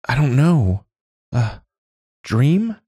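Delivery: high, unsure stammer; flustered. A narrator's reading. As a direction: stammer it out, caught. Dazed girl caught.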